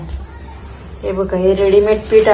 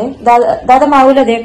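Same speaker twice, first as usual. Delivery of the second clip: calling out; 4 semitones higher, 7 dB louder, slower than usual. Real, not acted. Calling for the cat.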